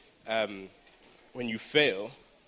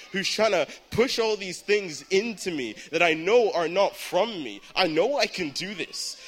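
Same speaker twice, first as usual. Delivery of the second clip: raised, high-pitched defiant rebuke; crying out at the devil; 4 semitones higher, 4 dB louder than usual. Retold or imitated. Exam-taker confronting the devil over fear, crying out defiantly. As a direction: cry it out like rebuking an enemy. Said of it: "screaming out".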